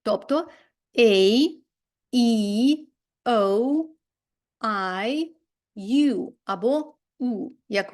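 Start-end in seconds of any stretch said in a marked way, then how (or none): 1.0-6.3 "A, E, O, I, U" as drawn-out alphabet names; long vowels "A, E, O, I, U", each separated by a pause. Shown as a demonstration. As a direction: say "A, E, O, I, U" long, one at a time. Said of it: "і є довгим голосним звуком".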